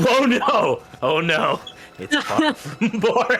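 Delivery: high, eager country voice; bubbly swamp hermit; excited folksy NPC voice. Acted. Host in NPC voice.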